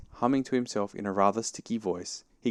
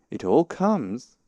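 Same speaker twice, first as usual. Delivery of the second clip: slow, louder, raised rebuke; stern; 5 semitones higher, 6 dB louder, much slower than usual. A narrator's reading. Rabbit rebuking stuck Pooh, stern. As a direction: spell it out slowly and firmly. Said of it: "said Rabbit sternly".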